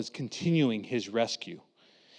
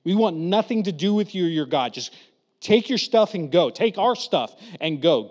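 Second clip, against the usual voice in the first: fed-up shouting; 4 semitones higher, 6 dB louder than usual. Retold or imitated. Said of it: "the people in Egypt were like".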